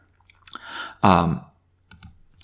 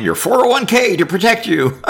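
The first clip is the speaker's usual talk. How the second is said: laughing as the voice jumps higher and louder; amused, mocking laugh through the words; laughing mockery; 13 semitones higher, 4 dB louder than usual. Real, not acted. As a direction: scoff at the four oh one K through laughter. Mocking trust in retirement savings.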